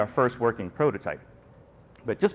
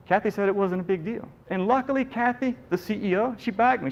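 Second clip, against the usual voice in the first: much higher, excited relief; 8 semitones higher than usual. Real, not acted. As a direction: lift it up with relief. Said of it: "excited relief".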